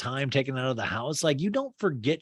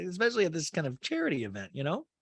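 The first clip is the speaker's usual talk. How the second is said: ordinary talk, nothing marked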